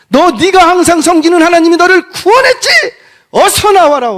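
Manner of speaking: very high, loud, urgent yell; throat-tearing shout. Acted. Preacher as Darius calling Daniel out of the den, shouting. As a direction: shout as if to tear your throat. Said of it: "목청이 찢어져라 소리를 질렀대요".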